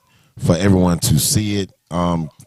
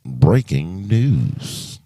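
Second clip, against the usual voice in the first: news anchor; much slower than usual. Pretend. Host jokes while setting up stream.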